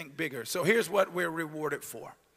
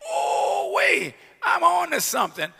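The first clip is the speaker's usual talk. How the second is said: excited realization; 9 semitones higher, 7 dB louder than usual. Retold or imitated. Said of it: "That means".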